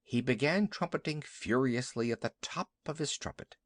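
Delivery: usual speaking voice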